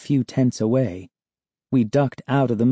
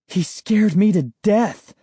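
shaken; 9 semitones higher than usual. Acted. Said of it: "I confessed".

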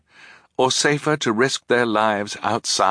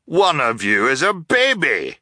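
higher, louder exclamation; cheerful cry; 7 semitones higher, 4 dB louder than usual. Acted. Captain greeting the children, cheerful cry. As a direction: blurt it out in delight. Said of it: "he cried".

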